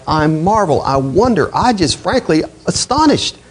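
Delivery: rising, voice climbing high in disbelief; upset astonishment. Retold or imitated. Preacher voicing upset Paul to the Galatians, astonished and high. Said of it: "He is upset".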